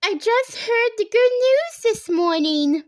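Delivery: thrilled to share the news; excited, half laughing. A narrator's reading. Stylist telling the women her news, excited and high. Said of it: "sort of laughing and crying".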